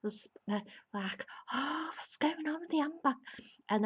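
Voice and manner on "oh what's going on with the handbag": higher whispered fretting; worried whisper. Retold or imitated. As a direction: whisper it anxiously to yourself. Fretting over handbag.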